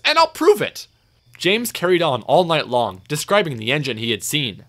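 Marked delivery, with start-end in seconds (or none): 0.1-0.9 very high, loud indignant vow; huffy defiance. A narrator's reading. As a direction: huff it out defiantly. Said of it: "huffed James".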